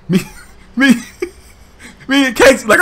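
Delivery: normal talking voice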